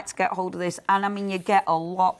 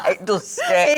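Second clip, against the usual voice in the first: laughing; 8 semitones higher, 5 dB louder, slower than usual. Real, not acted.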